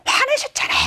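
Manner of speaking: acted teenage retort; accusing teen. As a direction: throw it back as an indignant kid.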